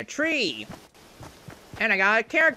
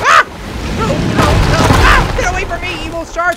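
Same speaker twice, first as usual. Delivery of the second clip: high, very loud panicked shrieks; loud shrieking fear fleeing the evil shark; mock panic scream; 10 semitones higher, 10 dB louder than usual. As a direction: scream at the monster. Shark attacks him.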